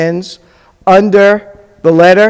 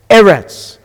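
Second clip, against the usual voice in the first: ordinary delivery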